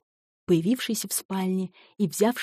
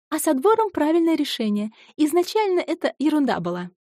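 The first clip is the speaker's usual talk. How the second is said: put-on lively young woman voice; 8 semitones higher, 4 dB louder than usual. Ioanna voiced higher.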